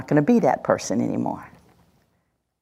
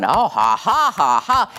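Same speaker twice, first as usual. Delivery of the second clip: mock laugh; 3 semitones higher than usual. Mocking someone's anger.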